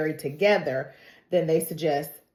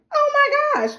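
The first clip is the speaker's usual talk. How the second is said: starstruck gasp; 7 dB louder than usual. Retold or imitated. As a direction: squeal it like a thrilled fan. Starstruck reaction to Meryl Streep's daughter.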